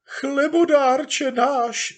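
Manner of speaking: put-on very high, slow, tearful old woman's cry. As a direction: wail it like a swooning old granny. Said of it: "vykřikla nějaká stařenka a zavzlikala". (stařenka vzlykavě vykřikne)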